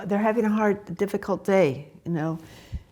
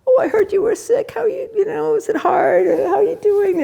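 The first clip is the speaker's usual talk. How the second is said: high, louder, cooing; sweet grandma voice; 13 semitones higher, 5 dB louder than usual. Retold or imitated. Grandma asking sick granddaughters how they are, high and cooing. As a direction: coo over the sick grandkids. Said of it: "I could say to my little".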